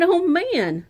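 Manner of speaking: high, loud "oh man"; surprised. Acted. Child narrator spotting wacky things, surprised exclamation. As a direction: exclaim it in shock.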